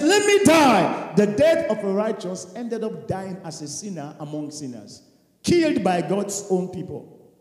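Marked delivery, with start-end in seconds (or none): 0.0-1.1 slow, high anguished plea; drawn-out desperate cry; pleading voice. Acted. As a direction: stretch it out like a dying prayer. Preacher voicing dying man's plea.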